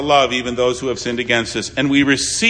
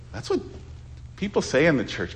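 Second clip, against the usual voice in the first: ordinary talk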